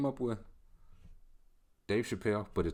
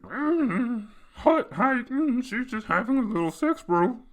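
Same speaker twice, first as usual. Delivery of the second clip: much higher, louder croaky frog-in-the-throat voice; exaggerated high raspy mock of a quarterback; Patrick Mahomes impression; 8 dB louder, slower than usual. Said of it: "frog in the throat voice".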